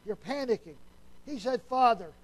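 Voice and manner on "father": crying out to Father. Acted. Jesus crying out to God.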